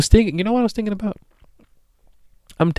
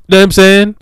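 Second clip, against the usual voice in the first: loud, higher shout; loud outburst; 7 semitones higher, 12 dB louder than usual. Real, not acted. Defending how he talks to listeners, loud outburst.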